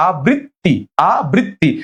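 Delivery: আবৃত্তি split as "a... brit... ti"; broken into syllables. Shown as a demonstration. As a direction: say আবৃত্তি piece by piece, single b. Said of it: "এরকম ভেঙে যাবে।".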